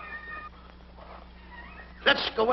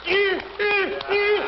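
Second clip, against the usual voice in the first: high, sharp seal barks; high-pitched imitation of a seal; seal impression; 8 semitones higher, much slower than usual. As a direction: bark like a seal, three times. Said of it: "A seal".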